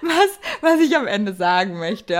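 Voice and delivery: words through laughter; laughing. Real, not acted. Lacht über eigenes Durcheinander. (lachend gesprochen)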